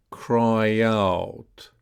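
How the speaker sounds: "Cry out" joined by a y glide; "Cry out" drawn out with no break; linked practice model. Shown as a demonstration. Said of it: "So let's practice that".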